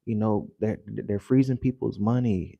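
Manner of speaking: ordinary talk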